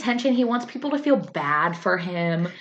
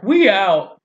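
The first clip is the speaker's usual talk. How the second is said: playful retold yell; mock announcement; 2 semitones higher, 7 dB louder than usual. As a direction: call it out like a crowd walking out. Acting out diners leaving in disgust.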